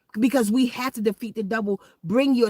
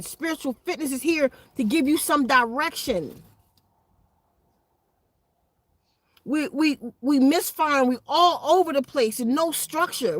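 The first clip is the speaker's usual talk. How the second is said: preaching; 6 semitones higher, 4 dB louder than usual. Acted. Opening address to followers.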